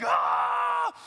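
high, imitated shriek of alarm; hammed-up high wail; mock baby scream. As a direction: scream like a baby being dangled. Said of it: "she's like".

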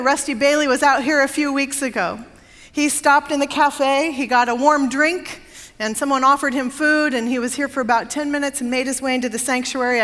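ordinary delivery

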